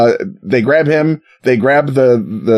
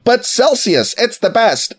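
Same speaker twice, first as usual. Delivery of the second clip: mocking whiny foreigner; 8 semitones higher than usual. Mocking metric fans' complaint.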